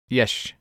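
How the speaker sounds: "yesh", the final S slurred into sh. Shown as a demonstration. Podcast host quoting an expert on drunken slur, slurred S. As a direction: say yes as a lazy "yesh". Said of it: "and the S".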